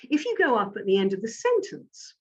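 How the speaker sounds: "sentence" swinging up like a question; upward inflection. Shown as a demonstration.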